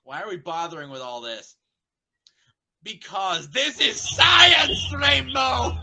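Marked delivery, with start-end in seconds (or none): 2.8-5.8 loud, slow, high-pitched proclamation; excited shout. Acted. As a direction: bellow it like a mad scientist. Twilight shouting about science.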